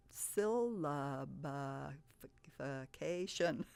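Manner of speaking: "syllabification" stretched slow, syllable by syllable; slow sounding out. Shown as a demonstration. Teacher slowly sounding out a long word for children. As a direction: draw out every syllable. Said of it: "let's look at a great big word".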